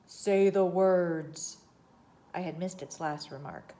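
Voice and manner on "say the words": loud, very slow, strange voice; louder command. A narrator's reading. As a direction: intone it as a stern order from the dark. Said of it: "repeated in a louder tone".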